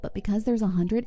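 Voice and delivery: ordinary talk, nothing marked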